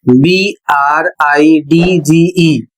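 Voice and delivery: letters spelled slowly one by one; slow spelling out. Shown as a demonstration. Teacher spelling handsome slowly for the students. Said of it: "spelling out the word".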